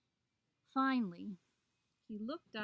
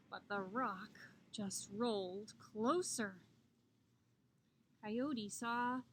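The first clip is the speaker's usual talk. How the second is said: ordinary delivery, nothing marked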